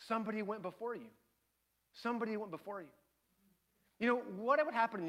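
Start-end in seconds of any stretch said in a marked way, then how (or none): 0.1-2.9 much softer, solemn statement; quiet, earnest reassurance; hushed sincerity. Real, not acted. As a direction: drop right down and let it land. Preacher turns to the solemn point.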